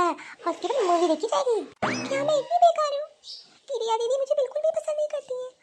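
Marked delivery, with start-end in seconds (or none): 2.1-5.5 put-on high, teary little girl; a small girl crying to herself, hurt; tearful child. Girl cries alone after insult.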